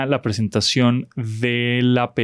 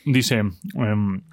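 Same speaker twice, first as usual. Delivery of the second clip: ordinary talk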